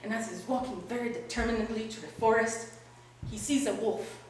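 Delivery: ordinary delivery, nothing marked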